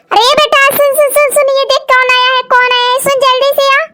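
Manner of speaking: high-pitched call to a son; alarmed mother summoning her son; urgent calling out. Acted. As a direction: call out urgently, words tumbling in repeats. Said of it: "क्यों चिल्ला रही हो इतना जोर जोर से".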